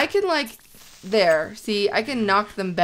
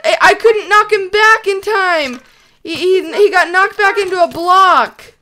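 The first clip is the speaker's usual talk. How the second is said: high, loud, worked-up voice; frantic apology; 9 semitones higher, 10 dB louder than usual. Real, not acted. Apologising to a friend for the fairy's near death, frantic. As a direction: cry it out frantically, pitched up.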